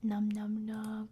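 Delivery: normal talking voice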